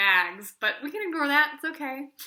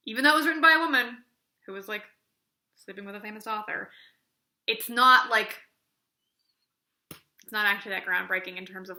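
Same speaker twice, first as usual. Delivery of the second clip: normal talking voice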